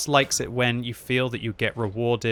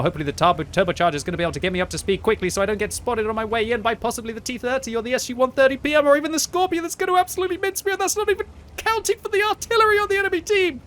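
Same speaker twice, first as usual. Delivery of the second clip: much higher and faster, breathless rising panic; panicky rush; 13 semitones higher, faster than usual. Real, not acted. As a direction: rattle it off as the panic climbs. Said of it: "You're gonna have an aneurysm live on YouTube".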